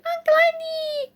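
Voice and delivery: very high, thin, stretched-out squeal; excited child's squeal. A narrator's reading. Small godson running to the door, ecstatic high squeal. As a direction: squeal it right at the top of your voice. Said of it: "It's greeted".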